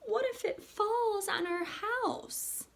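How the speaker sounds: high, slow frightened little voice; scared child. A narrator's reading. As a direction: fret like a small scared girl. Wemberly's fearful question.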